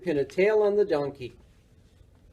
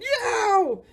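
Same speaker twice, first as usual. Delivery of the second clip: much higher yelp with a laugh; scared yell. A narrator's reading. Morris yelps at the clanging cans and runs. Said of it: "Morris yelled".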